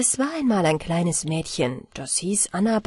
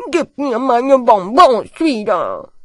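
put-on loud cheeky boy voice; cheeky demand; 2 semitones higher, 8 dB louder than usual. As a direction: demand it back boldly. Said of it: "sagte ganz frech".

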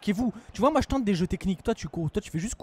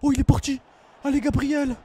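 excited cheering; 7 semitones higher, 5 dB louder than usual. Real, not acted. Gamer cheering his striker through on goal, excited. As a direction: cheer the run on as it builds. Cheering attack in FIFA match.

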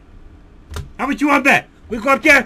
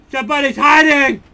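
ordinary talk, nothing marked

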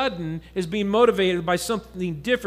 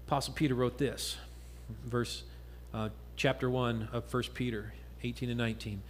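quiet scripture reading; 6 semitones lower, 7 dB quieter than usual. Acted. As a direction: read the verse gently, almost to yourself.

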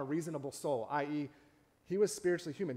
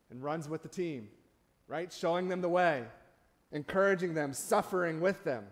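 ordinary talk

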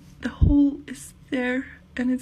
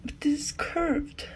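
normal talking voice

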